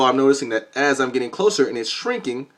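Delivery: usual speaking voice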